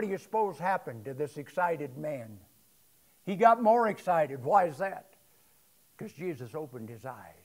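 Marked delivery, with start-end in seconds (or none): none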